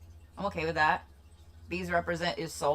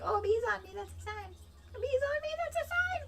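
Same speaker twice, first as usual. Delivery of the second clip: much higher, airy voice of a credulous believer; mocking her own voice; 7 dB quieter than usual. Mocking herself for taking bees on her as a sign, high airy ditzy voice. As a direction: play the ditzy sign-seer. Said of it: "So I'd be like".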